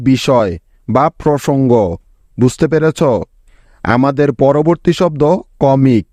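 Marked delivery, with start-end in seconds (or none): none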